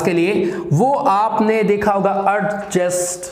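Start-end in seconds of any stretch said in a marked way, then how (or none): 2.2-3.2 "adjust" as ud-JEST with the d sounded; "adjust" the wrong way, ud...jest; common mispronunciation. Shown as a demonstration. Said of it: "This is not".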